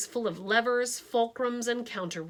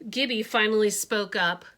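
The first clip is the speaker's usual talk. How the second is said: plain reading voice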